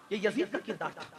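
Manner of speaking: low, soft, grave drop; subdued and low after a cry; hushed verdict. Real, not acted. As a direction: let the verdict fall quietly. Preacher drops after the charge.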